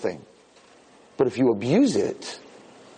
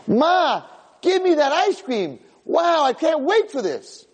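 put-on much higher, slow, gushing kid voice; excited little boy; 14 semitones higher, slower than usual. Boy begging his mommy for ice cream, high and thrilled. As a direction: squeal it out like a greedy little kid.

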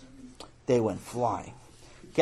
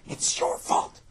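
voiceless accusing whisper; whispered blame; slower than usual. Acted. Brother blaming brother once father leaves, accusing whisper. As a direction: whisper it so Dad can't hear.

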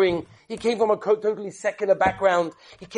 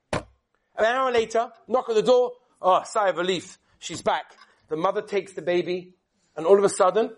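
normal talking voice

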